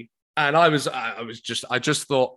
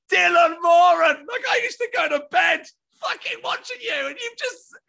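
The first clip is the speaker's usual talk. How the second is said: high, loud, breaking into hysterics; overwhelmed fan squeal; 13 semitones higher, 5 dB louder than usual. Retold or imitated. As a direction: gush in a squealing, beside-yourself outburst. Overwhelmed that idol joked about him.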